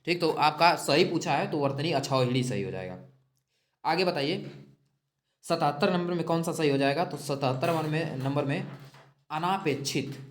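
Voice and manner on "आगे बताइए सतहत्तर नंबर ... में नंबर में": usual speaking voice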